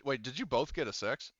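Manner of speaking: normal talking voice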